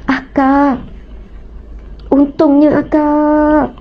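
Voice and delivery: slow and choked up with tears; a sister moved to tears; tearful, slow. Real, not acted. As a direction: speak through real tears, drawing the words out. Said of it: "masa tu saya nangis".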